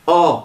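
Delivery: "o" as the open vowel of Paul alone; bare open O. Shown as a demonstration. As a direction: isolate "o" from Paul, mouth open. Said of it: "si on enlève le P". (« o » ouvert, comme dans Paul)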